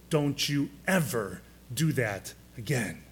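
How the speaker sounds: a hint of a low, stern rebuke; low, firm warning said steadily; calm but strong. Jesus rebuking his disciples for turning children away, calm but strong. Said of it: "with a calm but a very strong voice".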